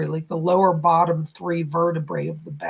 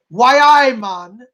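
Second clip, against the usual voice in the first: imitated Geordie, louder and higher at the start; Geordie accent; 4 semitones higher, 8 dB louder, much slower than usual. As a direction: playfully quote a spirit in Geordie. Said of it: "talking about Geordie speak".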